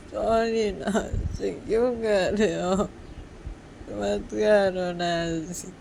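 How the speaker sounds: high, drawn-out crying voice; slow, whimpering appeal; tearful pleading. Acted. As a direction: plead through acted tears. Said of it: "फिर से रो रही हो".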